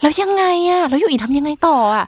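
high, eager mock curiosity; play-acted interest. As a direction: coax a child's story along theatrically. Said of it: "คือเล่นละครทุกวัน".

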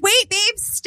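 high mock plea trailing away. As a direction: beg her to stay, trailing off. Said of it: "Were you like".

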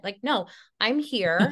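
normal talking voice

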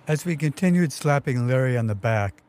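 ordinary delivery, nothing marked

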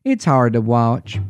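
low, slow Texan twang. Shown as a demonstration. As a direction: drawl it like a warm Texas nurse. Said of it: "in her warm Texan twang".

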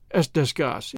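plain reading voice

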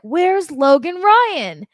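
high, slow, loud mock panic; hammed-up alarm at a missing player; panicked fans. Fans freaking out at the roster, mock panicked cry. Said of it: "they're like".

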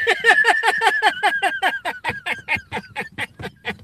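losing it; high wheezing laugh. Real, not acted. Cracking up at the mock warning from Nick.

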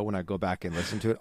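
normal talking voice

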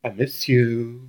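slow, lightly whiny pop-punk singer voice; a hint of a nasal pop-punk whine, drawn out; [name] whine. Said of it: "a whiny voice".

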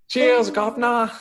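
a cheeky diner regular with a hammed-up British accent; bad British accent. Waiter thanked by customer, hammed-up bad British accent. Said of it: "bad British accents".

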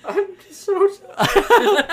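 high, slow words squeezed out through laughing; can't stop laughing while apologizing; real laughter. Real, not acted. Bursts out laughing at partner's joke.

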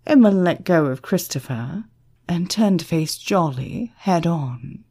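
plain reading voice

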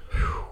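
long breathy whew; bracing sigh; weary exhale. Real, not acted. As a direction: blow out a breath before a tedious task. Bracing for a dull topic.

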